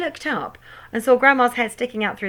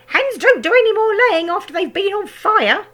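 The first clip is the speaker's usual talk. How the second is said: shouting grandma; 13 semitones higher, 8 dB louder than usual. A narrator's reading. Grandma shouting from the roof about the hen. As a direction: call it down with relish. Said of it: "Grandma shouted".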